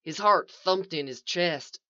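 usual speaking voice

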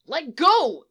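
loud, high angry snap; snapping. Acted. Struggling kid to his captor, snapping. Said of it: "the kid snaps".

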